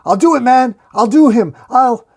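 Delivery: high, very loud desperate shouting; frantic yell, broken off at the end; screaming threat. A narrator's reading. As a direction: scream it like a cornered gunman. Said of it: "The pawn shop owner screaming".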